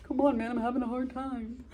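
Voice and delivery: soft, sorry-for-himself plea; small hammed-up hurt voice; mock hurt. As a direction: plead quietly, feeling sorry for yourself. Acting Romeo hurt at being laughed at.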